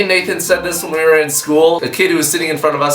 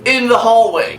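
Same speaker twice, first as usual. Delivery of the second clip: mock bossy; 7 semitones higher than usual. Bursting into the prank meeting, mock commanding. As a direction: bark orders at the committee. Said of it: "I busted up in that conference and I was like".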